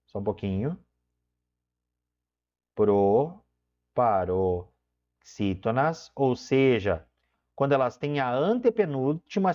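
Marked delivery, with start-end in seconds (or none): 2.8-6.1 drawn out. Shown as a demonstration. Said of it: "Vou botar aqui, ó, só um pouquinho".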